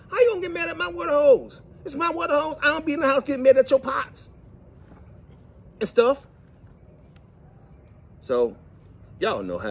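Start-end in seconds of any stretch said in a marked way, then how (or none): 0.1-6.3 high, mock-outraged protest; mock indignant. Teasing wife who got wet, mock indignant. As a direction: act playfully offended for the hose. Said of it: "I say".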